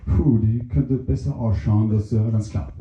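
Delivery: very low, dopey mushroom voice. Acted. Mushroom comments on plant.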